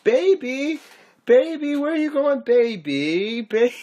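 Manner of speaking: put-on high, whiny pleading baby calls; mock whining lover. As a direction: mimic a needy guy whining after his girl. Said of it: "whining like a little girl".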